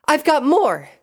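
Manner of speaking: high, loud shout back; defiant shout. A narrator's reading. Pinkie refusing to give up the fight, defiant shout. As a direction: shout back at full pitch. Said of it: "Pinkie shouted back".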